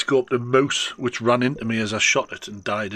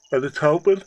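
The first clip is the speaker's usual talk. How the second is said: words stretched through a yawn; 6 semitones higher, 7 dB louder than usual. Real, not acted. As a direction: say it mid-yawn. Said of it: "Oh, excuse me, big yawn".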